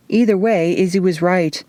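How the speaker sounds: ordinary delivery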